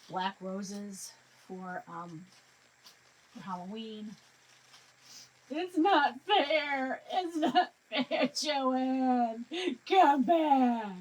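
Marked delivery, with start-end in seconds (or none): 5.5-10.9 hammed-up fake sobbing, slow and high; loud, drawn-out mock whine; mock crying. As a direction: wail it like a pretend tantrum over a closed store. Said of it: "I'm gonna cry".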